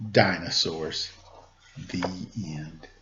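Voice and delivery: low, soft story close; quiet storybook ending. A narrator's reading. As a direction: let the story fade out gently. Story closing.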